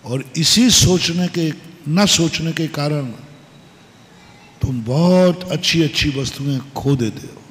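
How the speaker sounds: normal talking voice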